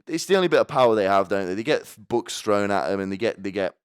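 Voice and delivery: usual speaking voice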